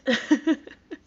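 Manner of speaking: high burst of laughter; disbelieving laugh. Real, not acted. Laughs at comment.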